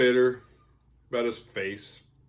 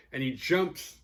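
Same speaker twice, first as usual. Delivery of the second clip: ordinary talk, nothing marked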